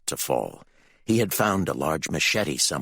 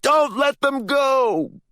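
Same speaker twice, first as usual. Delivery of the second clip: long, loud, high-pitched shout; yelled; 6 dB louder, much slower than usual. A narrator's reading. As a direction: yell an order across the dock, drawn out. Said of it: "one of them yelled".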